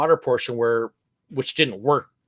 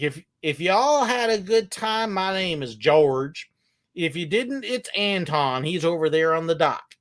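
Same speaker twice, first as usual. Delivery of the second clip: put-on Texas accent; 3 semitones higher than usual. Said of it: "laughing already because of the accent".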